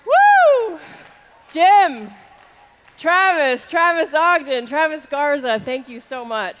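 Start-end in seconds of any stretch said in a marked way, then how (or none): 0.1-0.8 high, loud, held "woo"; whooping cheer. Real, not acted. Cheering on stage. 1.5-5.6 loud, raised roll-call from stage; calling out names. Real, not acted.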